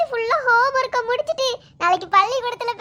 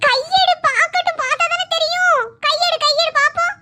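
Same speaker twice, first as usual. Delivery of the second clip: insistent mother; 4 semitones higher than usual. Acted. Mother insisting on seeing the leg, coaxing. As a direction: coax like a mother who won't take no.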